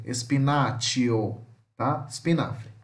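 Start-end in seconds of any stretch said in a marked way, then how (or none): 0.0-1.4 espinaccio with a tch sound, said slowly; slow model word. Shown as a demonstration. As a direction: stretch espinaccio out, tch in the middle. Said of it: "Aqui não fica com som de C".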